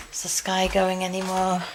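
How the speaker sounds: ordinary delivery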